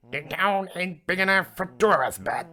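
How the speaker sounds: loud, high put-on drawling challenge; hammy high-pitched standoff voice; showdown challenge. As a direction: belt out a mock showdown line. Mock Wild West challenge.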